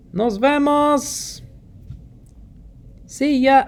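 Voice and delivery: high, slow, drawn-out see ya; high, slow farewell; playful sing-song goodbye. Shown as a demonstration. Teacher modelling goodbye phrase.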